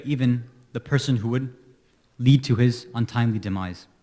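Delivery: subdued mourning recital; solemn narration. Acted. Opening of the martyrdom narration.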